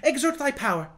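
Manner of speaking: put-on a boy king eager to see magic; impetuous zeal. A narrator's reading. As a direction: push the order out with eager zeal. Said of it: "said with impetuous zeal".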